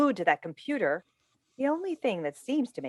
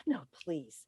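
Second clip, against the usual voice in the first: ordinary delivery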